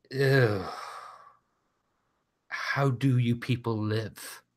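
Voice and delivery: haughty heel's revulsion; mock disgust. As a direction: sneer at the crowd, drawn out. Heel wrestler sniffs beer, insults crowd.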